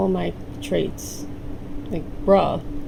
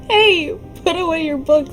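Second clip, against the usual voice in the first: loud, high scolding mother; stern mom; 9 semitones higher, 5 dB louder than usual. Acted. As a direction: snap at the kid like mom. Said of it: "says Mom".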